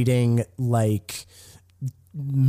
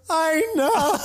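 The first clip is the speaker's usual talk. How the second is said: mock sobbing. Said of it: "Tiffany starts hysterically crying".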